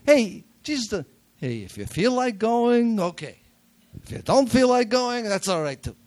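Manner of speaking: a preacher mocking a lax, half-hearted Jesus; Jackie Mason impression. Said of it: "I'm doing my Jackie Mason impression of Jesus".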